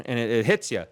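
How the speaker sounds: usual speaking voice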